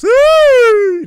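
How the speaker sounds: high, loud cheer; excited woo. Real, not acted. Hyping up a teammate's highlight with a loud woo. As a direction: let out a big hype woo.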